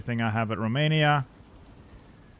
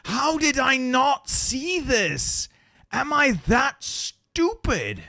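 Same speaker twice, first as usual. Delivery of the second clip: slow, higher, exasperated self-scolding; angry at himself; 8 semitones higher, slower than usual. Real, not acted. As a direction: fume at your own blunder, drawn out. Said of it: "Oh, I'm angry now".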